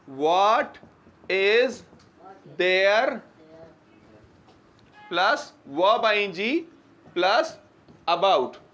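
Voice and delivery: What, is, there said word by word with pauses; slow dictated pattern. Shown as a demonstration. Teacher to students, dictating the grammar pattern slowly. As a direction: spell out the pattern piece by piece, slowly. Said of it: "teacher spelling out sentence pattern".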